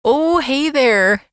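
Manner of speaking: a hammed-up Canadian accent, very slow. Shown as a demonstration. Co-host shows off her Canadian accent on request, mock Canadian. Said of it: "pretty good Canadian accent".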